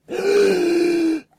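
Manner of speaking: high loud gasp; sharp realising gasp. Acted. Joy gasping as she realises the truth. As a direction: gasp as it dawns.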